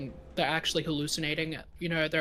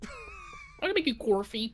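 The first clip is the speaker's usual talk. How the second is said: much higher, slow mock Australian; bad Australian accent; 14 semitones higher, slower than usual. Co-host echoing the texting typo, high bad Australian accent. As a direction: parrot it in a squeaky, drawn-out fake Australian. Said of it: "little bad Australian moment".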